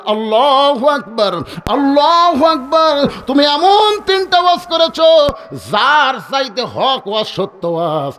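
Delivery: slow, drawn-out exclamation, climbing high; slow chanted sermon climax; fervent preaching. Acted. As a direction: cry it out in awe, stretching the words. Preacher acts Ali's amazed praise.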